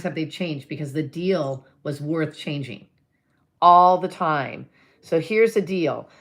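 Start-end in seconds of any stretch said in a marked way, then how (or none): 3.6-4.7 loud, slow, every word leaned on; emphatic answer. Real, not acted. Answering her own question for listeners, loud and slow. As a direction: drive the point home slowly.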